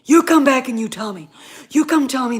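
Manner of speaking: a fan daring critics in a loud repeat. Real, not acted. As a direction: throw the dare back harder. Daring the listener to argue.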